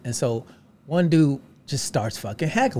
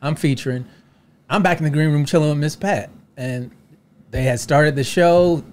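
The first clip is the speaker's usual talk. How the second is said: usual speaking voice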